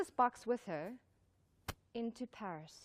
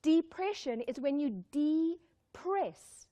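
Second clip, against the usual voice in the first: slow dictation, "de-press" pulled apart; depress split in two; 4 semitones higher, 4 dB louder, much slower than usual. Shown as a demonstration. Audience writes it down, depress said slowly in two parts. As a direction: stretch and separate both halves of depress. Repeats definition for note-taking.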